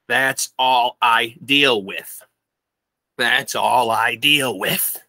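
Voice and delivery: "That's all I deal with" very slow, each word stretched; emphatic repetition. Shown as a demonstration. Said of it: "I'll say it again with emphasis".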